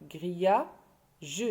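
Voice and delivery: "grillage" as grilla, long pause, ge; teacher's broken-up "grillage", grilla... je; split into syllables. Shown as a demonstration. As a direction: sound out "grillage" with the final "ge" set apart. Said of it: "on a trouvé deux syllabes".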